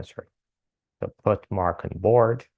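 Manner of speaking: ordinary delivery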